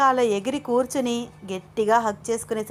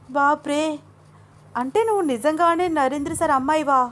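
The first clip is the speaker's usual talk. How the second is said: astonished; 6 semitones higher than usual. A narrator's reading. Ananya learning Junnu is Narendra's daughter, astonished. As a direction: gasp at the revelation. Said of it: "ఆశ్చర్యంగా అడిగింది".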